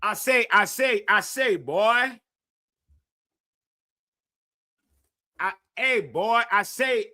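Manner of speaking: a hint of a southern cartoon rooster drawl; Foghorn Leghorn. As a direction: loose Foghorn Leghorn impression. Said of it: "go watch some Fargo and Leghorn".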